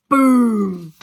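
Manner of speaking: big loud booming blast. A narrator's reading. Narrator acting the bomb on the Albert Hall with a loud boom.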